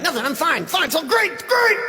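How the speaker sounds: brittle, high-pitched denial that climbs to a squeak; forced cheerfulness. Acted. Asked what is wrong tonight, forced cheer. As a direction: insist too brightly, voice shooting up. Insisting all is fine, clearly isn't.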